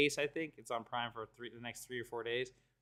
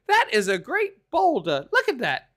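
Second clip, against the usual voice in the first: high goofy awe over a boulder; Donkey voice; 6 semitones higher, 7 dB louder than usual. Retold or imitated. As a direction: marvel at the rock in a high silly voice. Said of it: "he's like".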